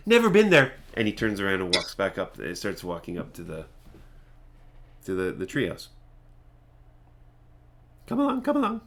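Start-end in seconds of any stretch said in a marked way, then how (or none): 0.1-0.7 high, louder eccentric voice; sudden high-pitched hermit quip; abrupt dismissal. Acted. Prattis abruptly dismisses his long musing. 8.1-8.8 high-pitched hermit beckoning; Prattis. Acted. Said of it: "sort of a cackling".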